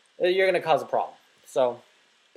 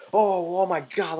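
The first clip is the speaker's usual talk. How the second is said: higher, drawn-out jeering exclamation; mock scandalised; 5 semitones higher, 3 dB louder, slower than usual. Gamer mimicking Europeans jeering at his walk, mock scandalised. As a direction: imitate a heckler's exaggerated disbelief. Mimics hecklers mocking the tourist.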